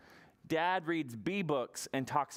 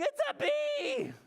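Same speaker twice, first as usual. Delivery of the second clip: put-on much higher, shrieking child; panicked scream.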